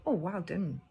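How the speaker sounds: plain reading voice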